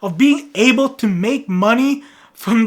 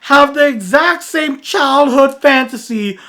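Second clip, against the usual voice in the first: loud, high-pitched comic outrage; indignant outburst; 4 semitones higher, 6 dB louder, slower than usual. Acted. Vlogger seeing his dream toy bought, indignant outburst. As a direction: rail at the unknown buyer, hammed-up.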